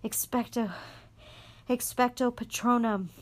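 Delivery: high strained plea; desperate plea. A narrator's reading. Harry defending Sirius to the Dementor, pleading. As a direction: hang on and cast.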